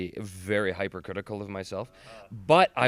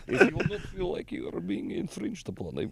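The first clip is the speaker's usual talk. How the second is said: higher put-on Russian voice; hammed-up Russian accent, accommodating; 7 semitones higher than usual. Offering to switch accents for the offended, mock Russian accent. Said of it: "I'll switch to Russian".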